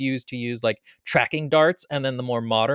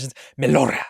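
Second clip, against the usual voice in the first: Melora in a hammed-up Spanish accent; mock Spanish accent; fake Spanish accent. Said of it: "pronounce all foreign words like they're Spanish".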